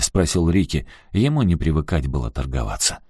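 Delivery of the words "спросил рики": ordinary delivery, nothing marked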